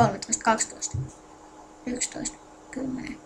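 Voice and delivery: countdown fading into a muffled, distant mic. Real, not acted. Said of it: "mun ääni nyt taisi kuulua vähän hillisenä". (vaimea, kaukainen mikin ääni)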